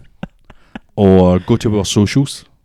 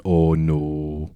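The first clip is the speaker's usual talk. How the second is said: low, soft string of regretful noes; retold dismay; 4 semitones lower, 5 dB quieter than usual. Recalling his younger self's reaction, low mock dismay. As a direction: let it sink down quietly. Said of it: "I remember back then going".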